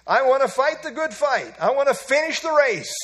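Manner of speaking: put-on old preacher proclaiming his goal, loud; loud declaration. Shown as a demonstration. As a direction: declare it to the whole hall. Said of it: "You know what I say?".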